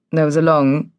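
plain reading voice

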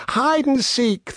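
high, slow, drawn-out animal chorus; all the animals calling out together, slowly; sing-song group call of animals; chorus of animals shouting. Acted. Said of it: "they shouted as if they were one".

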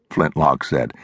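plain reading voice

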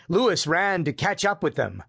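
plain reading voice

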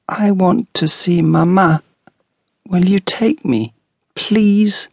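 put-on small girl asking to see her mamma; little girl pleading. A narrator's reading. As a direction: beg like a small child.

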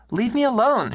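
woman at the bar yells at her husband; shouted. A narrator's reading. Woman at the bar shouting at her man in anger. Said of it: "she shouted".

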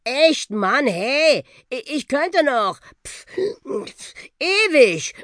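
high, halting protest broken by puffs; acted exhaustion, stretching the last word; out of breath. A narrator's reading. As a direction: puff and wheeze while bragging you could go on. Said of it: "als ging ihm gerade die Puste aus".

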